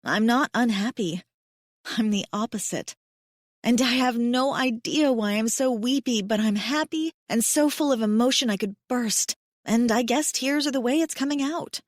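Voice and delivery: ordinary delivery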